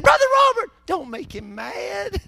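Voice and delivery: put-on very high squealed old lady plea; Granny King. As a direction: imitate an alarmed granny begging the preacher. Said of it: "She said".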